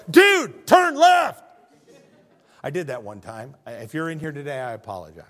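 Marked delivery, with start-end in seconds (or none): 0.1-1.4 very high, very loud shout; shout through a speaker. Retold or imitated. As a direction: blast it through the car loudspeaker. Shouting through car loudspeaker.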